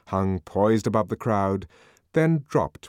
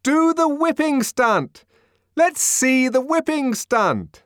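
eager crowd demand; 6 dB louder, slower than usual. A narrator's reading.

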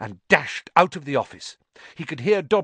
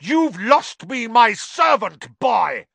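loud, higher, slow angry roar; furious snarl; 9 semitones higher, 7 dB louder, slower than usual. Acted. Malfoy lunging at Harry: furious. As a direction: lunge at the boy with rage.

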